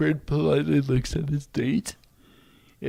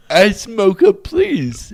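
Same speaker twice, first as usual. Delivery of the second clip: slow, raised, louder repeat of an order; acted careful restaurant order, pitched up; repeating to be understood; 6 semitones higher, 9 dB louder, slower than usual. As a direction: spell it out slowly for someone who missed it. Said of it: "Well, maybe repeat it".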